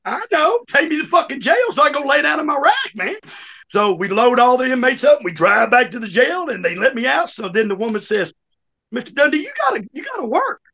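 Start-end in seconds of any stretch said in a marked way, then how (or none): 0.0-3.2 high-pitched, eager retold demand; defiant inmate. Inmate demanding a ride back to jail, defiant. 8.9-10.6 very high mock pleading insistence; insistent jail lady. Jail lady telling inmate to work, insistent.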